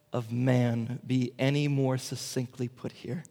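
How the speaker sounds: ordinary talk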